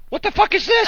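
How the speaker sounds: put-on indignant kid yelling; shocked outburst. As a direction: blurt it out in disbelief. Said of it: "I said".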